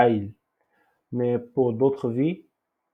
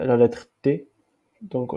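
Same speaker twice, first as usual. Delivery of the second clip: normal talking voice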